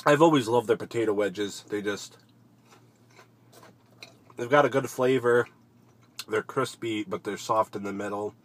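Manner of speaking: eating between words; talking while chewing. Real, not acted. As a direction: mouth partly full of food. Seen 3: Bites wedge at -5, chews with bulging lips while talking.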